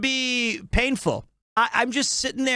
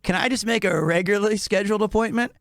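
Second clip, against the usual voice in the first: quick mock request; much faster than usual. Joking request.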